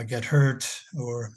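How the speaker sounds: ordinary talk, nothing marked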